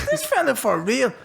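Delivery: high, drawn-out disbelief; incredulous. Retold or imitated. Man fuming inwardly at the security guy, incredulous.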